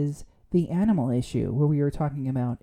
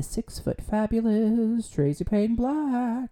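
grand introduction; 7 semitones higher, slower than usual. Pretend.

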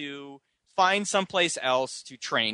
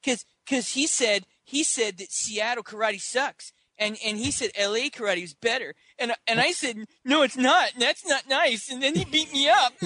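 mock tattling kid; 8 semitones higher than usual. Scotty telling the instructor why he was beaten, mock whiny complaint. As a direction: plead your case like an aggrieved child, hammed up.

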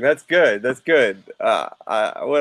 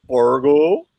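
Fargo stretched into a rising question; mock Fargo accent, rising on Fargo; 4 dB louder than usual. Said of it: "if I talk like that".

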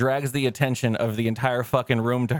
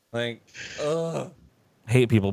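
normal talking voice

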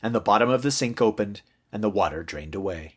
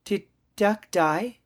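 put-on high, slow, hesitant child; drawn-out high question, rising at the end; worried little girl; 11 semitones higher, slower than usual. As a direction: ask haltingly, as a small child would. Girl asks the hard question.

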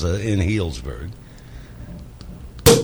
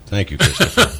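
ordinary delivery

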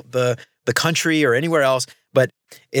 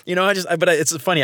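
normal talking voice

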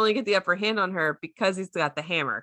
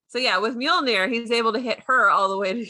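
ordinary delivery

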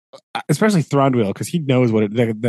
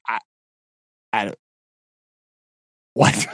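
halting stammer breaking into a laughed outburst; mock-stunned, laughing on the last word; dumbfounded. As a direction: act too baffled to speak, then burst out. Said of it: "they can't even figure out".

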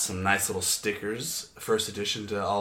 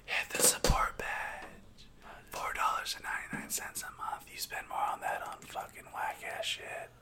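whispered snake-like hissing; parseltongue hiss; slower than usual. Pretend. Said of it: "[name]'s a parcel tongue".

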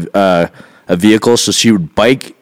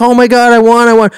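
high, loud mock jubilation; high-pitched retold excitement; thrilled winner; 13 semitones higher, 4 dB louder than usual. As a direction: imitate someone who just won the lottery. Sister scratches winning ticket, retold.

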